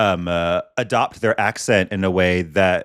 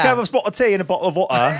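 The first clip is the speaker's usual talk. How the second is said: high mock English accent with dropped t's; laughing, exaggerated English accent; 7 semitones higher, faster than usual. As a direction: ham up a tea-ordering English accent. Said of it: "accents like that are offensive".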